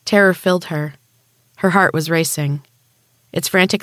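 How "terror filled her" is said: ordinary delivery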